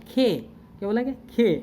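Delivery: खे with the aspirated ख sound in place of K; corrected sound. Shown as a demonstration. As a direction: say खे, breathing out on the K. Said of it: "क और ख का sound ले लेंगे".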